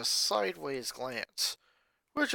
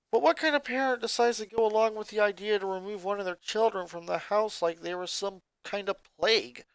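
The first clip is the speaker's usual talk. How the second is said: ordinary delivery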